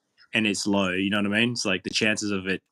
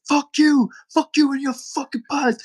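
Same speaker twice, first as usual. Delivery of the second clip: much higher, louder enraged yell; imitating angry inmate; 4 dB louder than usual. Angry inmate yelling at the food server, high enraged. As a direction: spit it out at the server. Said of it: "He's, like, just going crazy".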